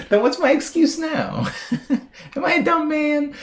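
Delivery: giggly self-mocking question; laughing at herself. Real, not acted. Laughs at chat's dumb kid remark.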